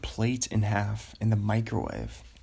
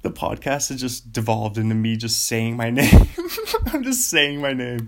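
laughing; 7 dB louder than usual. Real, not acted. Laughing at himself, loud.

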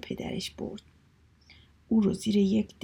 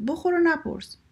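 high-pitched, punchy retort; acted bossy brush-off; emphatic curt refrain; 8 semitones higher, 4 dB louder than usual. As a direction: order it briskly, no questions allowed. Brothers' refrain about food.

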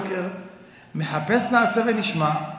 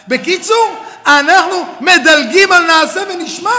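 higher, louder declaration; preaching shout; 5 semitones higher, 7 dB louder than usual. Real, not acted. Rabbi declaring to his students, preaching shout. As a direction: hammer the point.

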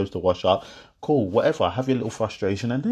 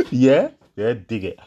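usual speaking voice

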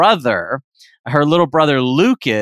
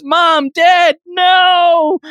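high, loud hammed-up scream; hammed-up horror-movie scream; screaming in terror; 13 semitones higher, 4 dB louder, much slower than usual. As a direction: wail it at the bodies in mock horror. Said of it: "She screams in terror".

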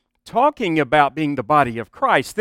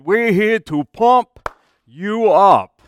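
slow, loud Austrian bodybuilder catchphrase; Austrian accent; 3 semitones higher, 5 dB louder, slower than usual. Retold or imitated. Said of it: "always say it in the Austrian accent".

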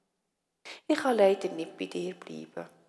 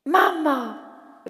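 higher, louder call for mama; little bunny calling home; 7 semitones higher, 10 dB louder than usual. Acted. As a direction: shout joyfully for mama. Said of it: "ruft der Josi".

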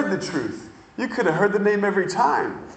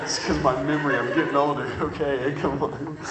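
ordinary talk, nothing marked

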